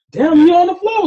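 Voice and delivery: high, loud, startled cry at a movie scene; mock shocked shout. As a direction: blurt it out like you just caught the twist. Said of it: "and you like".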